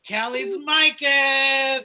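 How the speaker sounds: announcer intro. Acted. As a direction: stretch the name out like a ring announcer. Announcing the next comic.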